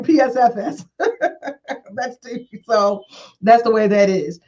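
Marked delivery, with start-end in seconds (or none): 0.0-0.8 "P S F S" letter by letter; spelled out. Shown as a demonstration. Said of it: "you don't say it like".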